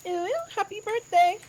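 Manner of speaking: put-on high cutesy baby talk; squeaky anime uwu voice. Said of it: "talk in the uwu voice".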